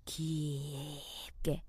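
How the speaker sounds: "깊게" stretched very long and low; drawn-out emphasis. Real, not acted. Host stressing deep regret, drawing out "깊게". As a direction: sink low and hold the first syllable of "깊게".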